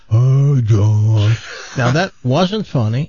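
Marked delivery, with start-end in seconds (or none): 0.1-1.3 low, drawn-out mock greeting; mocking imitation. Host replaying Doc's comedy greeting, mock imitation. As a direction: send up his greeting, drawing it out low. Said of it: "here's what you did".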